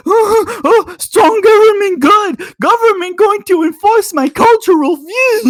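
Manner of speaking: high, loud, dim-witted parody; a high, loud dumb-brute mock of a statist; mocking caveman voice. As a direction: play them as grunting simpletons. Mocking right-wing statist views.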